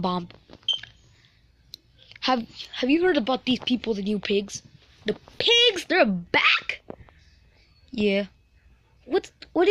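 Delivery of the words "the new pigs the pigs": normal talking voice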